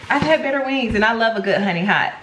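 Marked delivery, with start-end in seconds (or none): none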